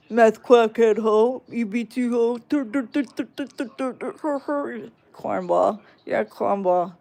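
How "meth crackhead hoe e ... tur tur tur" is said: put-on sneering voice with a silly trilled taunt; mocking voice. Streamer parroting a troll's insult, mocking voice. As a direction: parrot their insult back like a clown.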